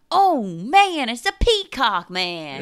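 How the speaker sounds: high loud excited exclamation. Retold or imitated. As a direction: exclaim it with delight. Quoting her own note.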